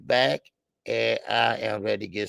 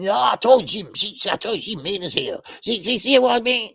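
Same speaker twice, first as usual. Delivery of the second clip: high, loud, stammering dad voice; worked-up mock father, pitched well up; imitating agitated dad; 9 semitones higher, 6 dB louder than usual. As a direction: mimic dad protesting, tripping over the words. Voices dad arguing back, stammering.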